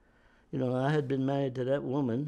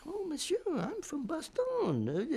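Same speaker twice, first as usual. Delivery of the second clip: imitating the Belgian woman; 3 dB quieter, slower than usual. Veteran voices Belgian woman's words.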